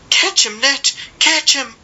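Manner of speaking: put-on high urgent cry to catch the boy; mother crying out. A narrator's reading. Mother begging the twin sister, high cry. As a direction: plead for the twin to grab him. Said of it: "crying to the nearer sister".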